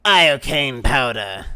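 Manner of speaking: low, slow pronouncement; deep, drawn-out and deliberate; mock-grave film quote. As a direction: declare it like a poison expert. Quoting a film line on poison.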